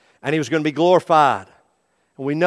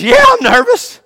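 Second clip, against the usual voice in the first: much higher, squeaky fearful admission; nervous patient; 9 dB louder than usual. Acted. Patient before surgery, high-pitched nervous reply. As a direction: let the fear squeak out. Patient admits fear in skit.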